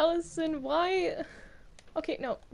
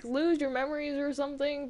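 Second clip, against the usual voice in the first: ordinary delivery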